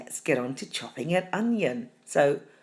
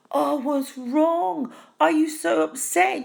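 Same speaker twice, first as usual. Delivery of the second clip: much higher, slow, fussing worry; mock-anxious friend voice, pitched way up; concerned friend; 10 semitones higher, slower than usual. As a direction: coo over someone you think is crying.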